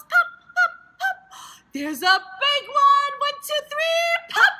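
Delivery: high squeaky pops; excited. Pretend. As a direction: pop them with glee. Popping bubbles game.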